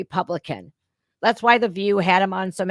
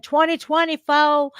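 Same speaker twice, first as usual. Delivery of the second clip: Kamala Harris; 7 semitones higher, 4 dB louder than usual. Retold or imitated.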